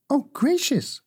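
put-on high, startled little girl; surprised cry. Dorothy learns she meets a real witch, surprised. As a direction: gasp it out in childish surprise. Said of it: "cried Dorothy".

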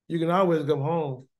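ordinary delivery

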